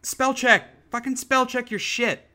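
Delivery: exasperated. Real, not acted. Ranting at bad spellers, exasperated. Rant about other people's spelling mistakes.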